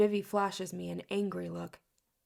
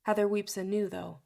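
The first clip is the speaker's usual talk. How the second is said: usual speaking voice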